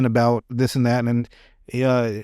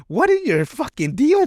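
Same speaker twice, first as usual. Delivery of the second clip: exasperated mock rant; 10 semitones higher than usual.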